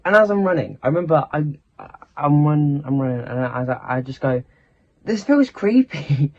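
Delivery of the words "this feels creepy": high, slow, spooked little voice; mock creeped out. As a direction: draw it out like you're scared of the dark. Running round the back at night.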